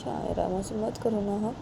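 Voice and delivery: quiet please-don't-be-mad plea; soft pleading. Acted. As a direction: coax her to turn around. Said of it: "तो बानी बोलती है".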